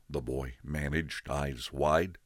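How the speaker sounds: ordinary delivery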